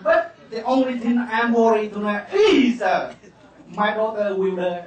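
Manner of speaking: hammed-up toast from the bride's father; Quảng Nam accented English. Father at daughter's wedding toasts guests, hammed-up Quảng Nam accent. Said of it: "nói tiếng Anh mà ông nói bằng giọng Quảng Nam".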